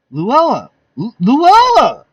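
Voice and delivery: high, loud terrified cry of her name; terrified scream. Acted. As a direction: scream for mercy as she comes at you. Victim screaming at knife.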